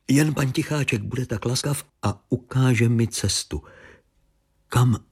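ordinary delivery, nothing marked

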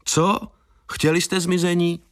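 angry retort to a prankster who faked a disappearance; combative outburst. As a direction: throw the question at him like a shove. Andrej confronts the prankster.

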